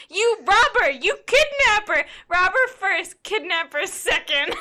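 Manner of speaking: high, loud indignant shout; hammed-up furious accusation; a mock furious old man; mock outrage. Retold furious Aborn quote.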